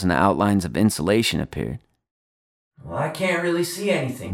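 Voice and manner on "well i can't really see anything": high, faint voice from far off; distant boy. A narrator's reading. Charlie under the house to Lonnie, distant and faint. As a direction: call back softly as if from a crawl space.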